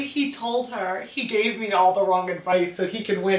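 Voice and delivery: usual speaking voice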